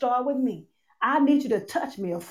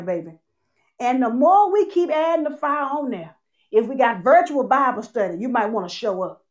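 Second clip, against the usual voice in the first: ordinary talk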